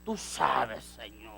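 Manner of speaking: respuesta suave. Acted. Pedro in ecstasy answers God.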